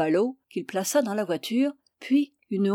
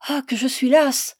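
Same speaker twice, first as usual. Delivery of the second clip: a touch of weary groan, higher; mild plaintive moan; 4 semitones higher, 5 dB louder than usual. A narrator's reading. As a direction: sigh out the tiredness. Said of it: "gémit la passante d'un ton navré".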